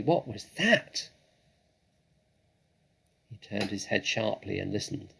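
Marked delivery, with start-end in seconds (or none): 0.0-1.1 higher, sudden alarm; startled fox. Acted. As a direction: freeze at the noise.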